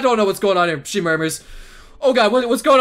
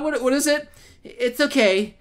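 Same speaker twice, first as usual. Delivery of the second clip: ordinary talk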